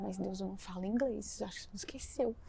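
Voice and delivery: timid quiet reply to God; quiet protest. Retold or imitated. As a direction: murmur the objection under your breath. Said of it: "Eu falei".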